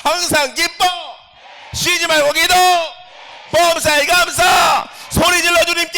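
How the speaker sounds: high, loud, slow shouted commands; full shouting proclamation. Shown as a demonstration. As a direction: roar each line to the congregation. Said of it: "다시 한번 해보겠습니다". (아주 높고 크게 외치는 선포)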